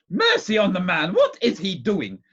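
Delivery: loud, high exclamation of impatience; impatient cry. A narrator's reading. Aunt faced with a tongue-tied doctor, crying out impatiently. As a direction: cry out in exasperation. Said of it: "cried my aunt impatiently".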